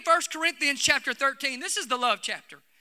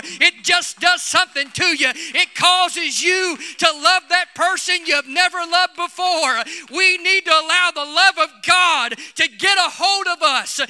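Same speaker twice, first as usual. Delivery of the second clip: loud, rising Pentecostal preaching with shouts; louder and louder preacher's exhortation; full-force preaching; 3 semitones higher, 6 dB louder than usual. Acted. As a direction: preach it hard and stir the crowd.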